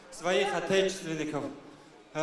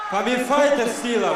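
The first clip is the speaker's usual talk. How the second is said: loud; 6 semitones higher, 6 dB louder than usual. Real, not acted. Fighter's rallying shout.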